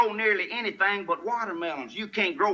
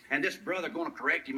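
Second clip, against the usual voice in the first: ordinary talk, nothing marked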